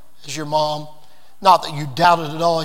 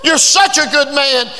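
grateful exclamation; 7 semitones higher than usual. Retold or imitated. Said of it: "hugged him and said".

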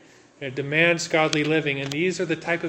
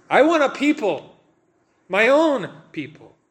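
God's longing voice; 7 semitones higher, 5 dB louder than usual. Acted. God voiced wanting a people.

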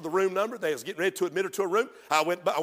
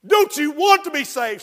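pleading; 8 semitones higher, 10 dB louder than usual. Retold or imitated. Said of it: "I was weeping and I was crying and begging her".

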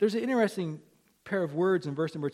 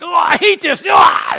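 mock tantrum shout, pitched way up; annoyed outburst; 13 semitones higher, 18 dB louder than usual. Pastor imitates the king with a leaking pen, hammed-up annoyed shout. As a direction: explode in a loud, high imitation of exasperation. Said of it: "And he's just annoyed".

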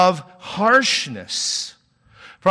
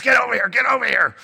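fast, higher-pitched mock parent yelling; 7 semitones higher, 4 dB louder, faster than usual. Parent yelling at kids playing outside, mock yell. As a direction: hurry the child over, half-shouting. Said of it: "having to yell at their kids".

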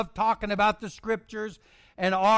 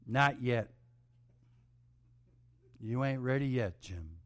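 quiet refusal; 7 semitones lower, 7 dB quieter than usual. Retold or imitated. Preacher refuses again.

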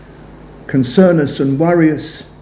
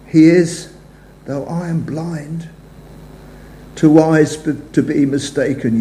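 hymn recitation. Acted. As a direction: recite the verses as a prayerful poem. Recites hymn verses to congregation.

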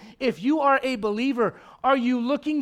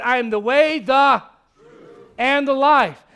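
loud, ringing declaration of Christ's words; booming proclamation; 2 semitones higher, 10 dB louder, slower than usual. Acted. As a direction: proclaim it to the whole church. Preacher booms Jesus' words.

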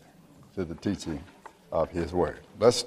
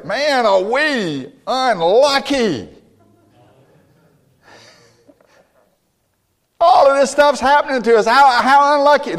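much higher, louder self-pitying grumble; mock whiny complaint; 12 semitones higher, 5 dB louder than usual. Preacher mocking what the brothers did not say, whiny. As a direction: ham up a sorry-for-ourselves moan. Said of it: "they didn't say".

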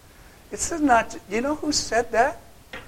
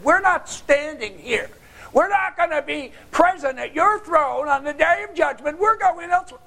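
mock defiant goats; 8 semitones higher, 5 dB louder than usual. The preacher voices the wicked goats refusing judgment, mocking. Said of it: "any of those goats said".

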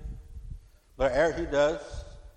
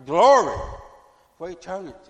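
sudden loud cry of glory; shouted praise; 3 semitones higher, 8 dB louder than usual. Real, not acted. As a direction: burst out praising God. Preacher exclaims in praise.

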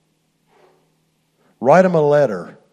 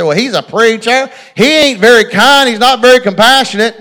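higher, louder aggrieved complaint; indignant complaint; 9 semitones higher, 8 dB louder than usual. Retold or imitated. Young man complaining to the Lord, higher and louder. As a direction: whine your grievance up loud. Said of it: "I said".

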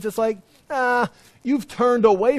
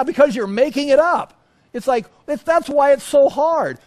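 higher and louder, pressing the point hard; rising preacher's shout; 7 semitones higher, 6 dB louder than usual. Real, not acted. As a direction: build to an exasperated near-shout. Preacher escalates against lazy sermons.

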